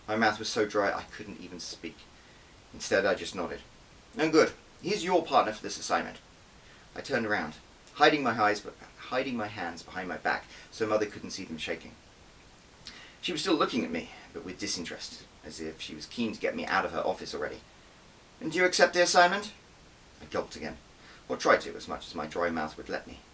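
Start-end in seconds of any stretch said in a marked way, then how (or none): 4.2-6.2 higher, louder curt boss; lightly voiced curt spymaster; brisk boss. Spy chief assigning the partner, curt. 18.4-19.5 much higher, louder impatient question; impatient boss. Acted. Disinterested boss pressing her agent, impatient.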